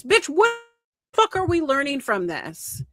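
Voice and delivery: high-pitched exasperated retort; indignant comeback; incredulous outrage. Real, not acted. Outraged retort to the retold quote.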